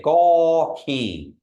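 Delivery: gaw keen slow, gaw stretched long. Shown as a demonstration. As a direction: hold the gaw vowel long. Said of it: "you're elongating it".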